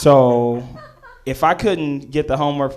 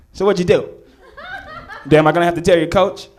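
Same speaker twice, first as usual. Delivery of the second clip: casual dean; 3 semitones higher, much faster than usual. Retold or imitated. Dean voiced.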